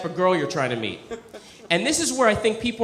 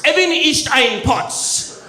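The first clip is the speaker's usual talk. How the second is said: slow, louder fake German accent; mock German again; 5 semitones higher, 3 dB louder, much slower than usual. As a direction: spit it out like German parents. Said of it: "Which I think means".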